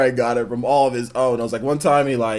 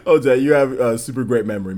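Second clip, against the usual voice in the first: usual speaking voice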